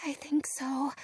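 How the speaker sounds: soft, trembling whisper. Acted. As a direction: whisper back, scared. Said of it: "I whisper back, trembling".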